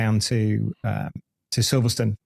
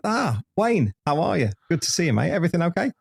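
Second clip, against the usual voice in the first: mock greeting, pitched much higher; cheery greeting; 6 semitones higher than usual. Old racer greets Dave in the paddock, high cheery mock greeting. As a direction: play an old mate greeting a friend high and bright.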